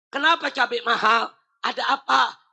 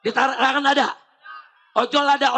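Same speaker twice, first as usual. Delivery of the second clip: normal talking voice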